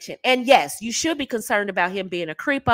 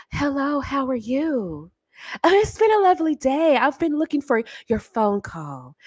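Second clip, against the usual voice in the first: sultry phone voice; 3 semitones higher than usual. Shown as a demonstration. Coach showing ladies the phone sex energy. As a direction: coo it like he's the only one. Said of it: "The same energy you have for phone sex".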